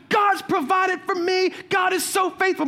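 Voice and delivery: high, excited praise; joyful shouting. Retold or imitated. Lady dancing over the bread, excited shouting. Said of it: "she starts shouting".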